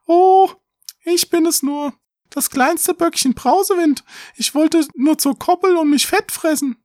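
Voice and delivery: very high little goat squeak; piping falsetto kid goat; tiny fine voice. A narrator's reading. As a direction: answer as the smallest billy goat, high and thin. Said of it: "antwortete mit feinem Stimmchen".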